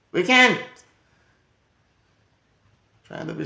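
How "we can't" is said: high, loud flat denial; emphatic no. Real, not acted. Comic telling crowd kids in Jamaica can't. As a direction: shut it down loudly.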